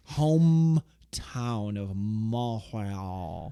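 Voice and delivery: drawn-out, slow French "Montreal"; mock French accent. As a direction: stretch the name out in exaggerated French. Drawn-out mock French place name.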